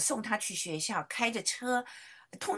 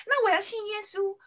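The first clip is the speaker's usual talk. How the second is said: put-on high, louder childlike eagerness; eager child; 11 semitones higher, 5 dB louder than usual. Daughter eagerly telling mom she wants to believe, high child voice. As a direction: blurt it like a keen little girl.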